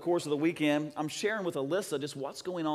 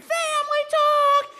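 shrieked much higher and louder; child's scream; 11 dB louder than usual. Retold or imitated. Imitating daughter Nora calling a family talk, screaming. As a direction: belt it like a little girl summoning everyone. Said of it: "she'll scream".

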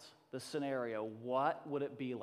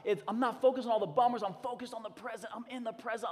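ordinary talk, nothing marked

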